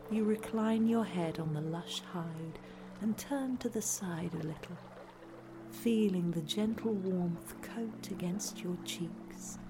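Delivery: usual speaking voice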